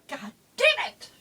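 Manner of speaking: loud exasperated swearing; frustrated curse. Real, not acted. Cursing after sneeze.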